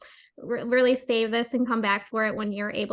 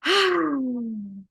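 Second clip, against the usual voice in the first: long audible sigh out the mouth; demo sigh. Shown as a demonstration. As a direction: let out a noisy sigh. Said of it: "it sounds like this".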